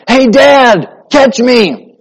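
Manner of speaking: put-on a boy shouting down to his father; child calling out.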